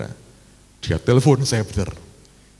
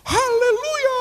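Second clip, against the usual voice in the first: very high hammed-up hallelujah; mock squeal of praise, voice leaping way up; jubilant cry; much slower than usual. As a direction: act out jumping for joy. Retells jumping for joy at news.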